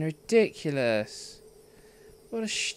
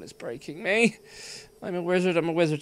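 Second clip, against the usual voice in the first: ordinary talk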